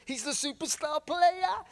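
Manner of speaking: high, drawn-out excited squeal; bursting with excitement. Retold or imitated. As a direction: imitate someone about to burst with excitement. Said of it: "absolutely about to burst because he's so excited".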